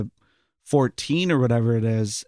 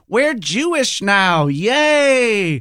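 put-on higher, louder childlike cheer rising into yay; Rod and Todd cheering; 7 semitones higher, 5 dB louder, much slower than usual. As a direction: kids gleefully announce it, jump up on yay. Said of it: "I just remember them going".

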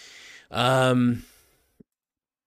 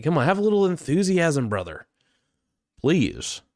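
higher and louder, egging him on; pleading for enthusiasm; 7 semitones higher, 3 dB louder than usual. Real, not acted. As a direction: come on, pump him up. Urging bored Lex to liven up.

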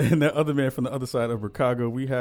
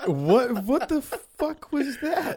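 incredulous; 12 semitones higher, much slower than usual. Real, not acted. Reacts to the strange rhyme.

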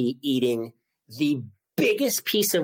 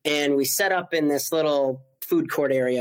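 ordinary talk, nothing marked

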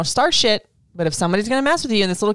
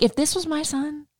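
normal talking voice

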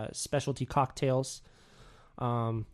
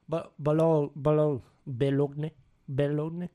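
a clumsy try at "Boulogne" in a French accent; bad French accent; 3 dB louder than usual. Shown as a demonstration. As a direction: take several awkward French-accented stabs at "Boulogne". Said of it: "I can't do French for shit".

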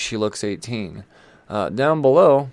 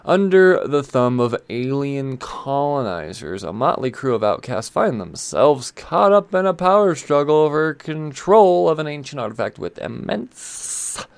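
dramatic blurb read; 4 semitones higher, 4 dB louder than usual. Acted. Host performing a comic blurb about alien colonizers, dramatic read. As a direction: build it up like a dramatic announcer.

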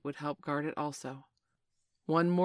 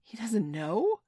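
high, drawn-out incredulous question; scandalised, rising query; shocked exclamation; 6 semitones higher, 5 dB louder, slower than usual. A narrator's reading. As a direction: exclaim it, swooping up in disbelief. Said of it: "so shocked that she exclaimed".

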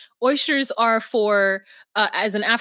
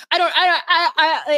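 mock freak-out; 7 semitones higher, 4 dB louder, faster than usual. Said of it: "her brain freaks out".

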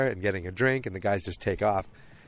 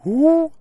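high, loud "ooh"; acted ooh; 11 dB louder than usual. Acting out the wife's look after the kiss, high loud ooh. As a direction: let out a high-pitched ooh. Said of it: "the look on her face is".

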